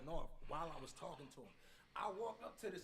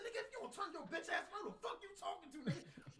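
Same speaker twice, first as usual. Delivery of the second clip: very high, held-down threat; high mock threat without volume; retold confrontation; 13 semitones higher, 4 dB quieter than usual. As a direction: act out a squeezed, high-pitched challenge.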